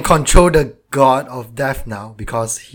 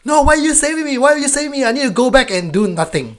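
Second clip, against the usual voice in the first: high, whiny mock voice; a hammed-up whining damsel; mocking the heroine; 10 semitones higher, faster than usual. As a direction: parody her as whiny and senseless. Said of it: "She was like".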